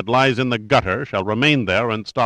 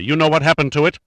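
raised, higher and louder; savage snap; 6 semitones higher, 4 dB louder, faster than usual. A narrator's reading. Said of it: "Rondheim snapped savagely".

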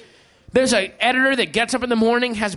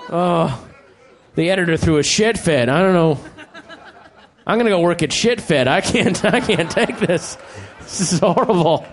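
lower, mock upset whining; a touch of hammed-up despair; distraught fired employee; 6 semitones lower than usual. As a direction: whine like a man who just got fired. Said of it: "Then some guy has to go home".

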